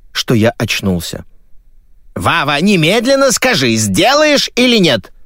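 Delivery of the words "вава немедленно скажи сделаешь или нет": high, insistent motherly demand. Acted. Mother demanding yes or no from her son, demanding. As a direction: press for an answer in a high voice. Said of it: "потребовала маменька".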